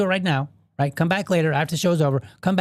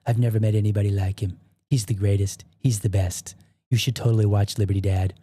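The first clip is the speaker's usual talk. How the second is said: hammed-up Trump praise, only a hint of his voice; Donald Trump impression; 6 semitones lower than usual. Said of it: "put on your Trump shoes".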